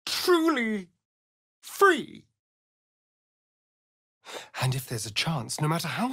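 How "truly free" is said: yearning. Acted. Yearning climax about freedom.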